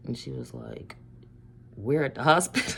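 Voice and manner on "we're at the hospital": louder, breaking into laughter; laughing. Retold or imitated. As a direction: retell it while cracking up. Breaks up retelling the reveal.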